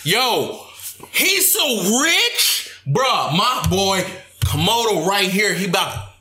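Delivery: high, drawn-out hype man; hyped-up. Acted. Friend hyping up a rapper buddy, excited hype.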